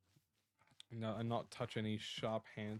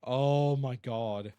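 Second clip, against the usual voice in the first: startled; 9 dB louder, much slower than usual. Real, not acted. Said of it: "That was actually terrifying".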